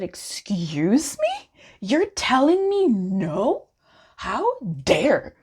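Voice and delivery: slow, drawn-out mock offence; mock indignation. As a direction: play the outraged diva, tongue in cheek. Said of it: "I said".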